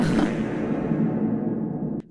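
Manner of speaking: long voiceless laugh; wheezing laugh. Real, not acted. Host overcome by listeners' jokes, wheezing laugh. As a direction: laugh until only air comes out.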